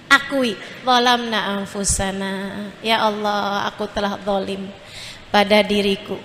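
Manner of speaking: low, soft, contrite prayer; humble prayer. Acted. Preacher leads mothers in a prayer of repentance, softly and humbly. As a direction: confess quietly before God.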